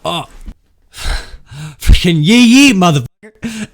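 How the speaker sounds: high, loud mock yell of frustration; retold cursing shout. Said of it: "and he's like".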